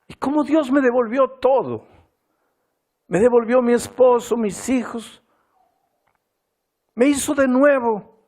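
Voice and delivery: high, loud emotional outpouring; overwhelmed gratitude. Retold or imitated. Wife telling the pastor what God restored, high emotional cry.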